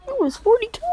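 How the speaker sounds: high, loud shocked outburst; acted astonishment. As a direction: squeal it in mock disbelief. Group stunned by his age.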